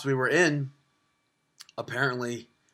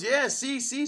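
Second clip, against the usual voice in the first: high, eager seller voice; vendor voice; 10 semitones higher, slower than usual. Retold or imitated. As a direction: pitch it up like a pushy shopkeeper. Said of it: "He goes".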